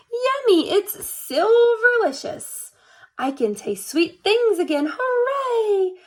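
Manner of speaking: high, happy exclamations; delighted. A narrator's reading. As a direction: relish every word of the treat. Delighted to taste sweets.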